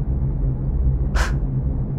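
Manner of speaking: deep weary sigh out; long low sigh. Acted. Tired scout sighing heavily between thoughts, a long low sigh. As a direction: let a long heavy sigh go.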